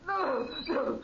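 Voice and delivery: high desperate no's; terrified protests. Acted. Captive man begging as he's laid on the bed, high terror. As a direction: plead in terror.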